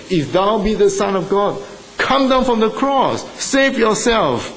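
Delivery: high, jeering sneer at the crucified; sneering mockery, pitched high; mocking taunt. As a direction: taunt him from below, scornful. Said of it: "they mocked him".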